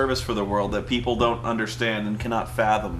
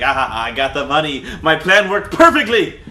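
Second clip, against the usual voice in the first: louder, laughing mock villain brag; gloating villain; 7 dB louder than usual. Killer gloating over his money, mock triumphant laugh. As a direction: crow over the cash like a cartoon bad guy.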